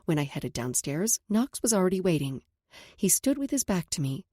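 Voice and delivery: plain reading voice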